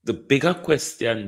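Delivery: usual speaking voice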